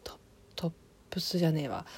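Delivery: a quick whispered correction to herself; talking to herself while searching for the word, a fast whisper; whispered aside. Real, not acted. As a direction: mutter it under your breath, quickly.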